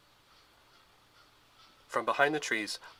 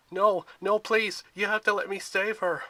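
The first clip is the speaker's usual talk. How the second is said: loud, high pleading; desperate begging; 9 semitones higher, 5 dB louder than usual. A narrator's reading. Percy begging the hunters to release him, loud desperate plea. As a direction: beg them to let you go after her. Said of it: "he begged".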